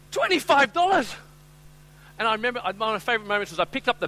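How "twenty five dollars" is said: high, slow, incredulous exclamation; mock-appalled customer; indignant disbelief. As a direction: stretch out the outrage at the price. Acted haggling, customer balks at price.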